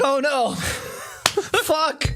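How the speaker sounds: put-on high, panicked kid voice; mock panic.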